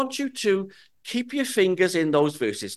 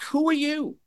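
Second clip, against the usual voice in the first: much higher startled call; shouting back; 10 semitones higher than usual. Acted. Abner shouting back at the unseen voice, high and startled. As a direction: fling the question back across the dark. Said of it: "he shouts back".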